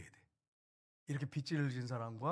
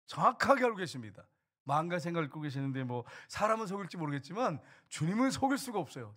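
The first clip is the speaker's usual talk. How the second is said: ordinary talk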